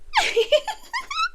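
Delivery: high, eerie little laugh; creepy giggle. Acted. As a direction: giggle with delighted menace. Giggle after threatening to keep.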